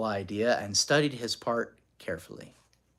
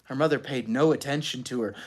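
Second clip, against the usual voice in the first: plain reading voice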